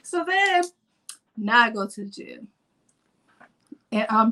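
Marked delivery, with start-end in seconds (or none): none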